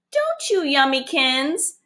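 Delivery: slow, high sing-song coo to a pet; sweet pet talk, drawn out; cooing baby talk. Acted. Yolanda coos to her fish.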